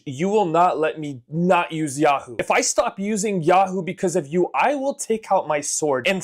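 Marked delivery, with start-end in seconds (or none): none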